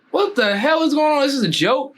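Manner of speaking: high-pitched retold disbelief; can't-believe-this astonishment; incredulous. Reacting to idol holding his jacket.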